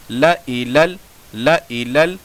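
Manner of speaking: ला इलल with a short ला; measured ला इलल, alif silent; correct recitation. Shown as a demonstration. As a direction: clip ला before इलल. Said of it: "पढ़ने का तरीका".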